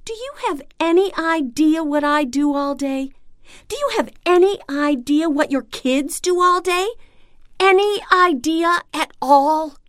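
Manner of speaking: high-pitched indignant outburst; outraged retort. Acted. Stay-at-home parent firing back at a dismissive partner, outraged. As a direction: demand it, pitched well up and rising in outrage.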